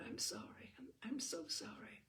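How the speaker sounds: whispered shaken apology; stricken whisper. A narrator's reading. Eleanor apologising to Brat, whispering, stricken. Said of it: "Eleanor looked stricken".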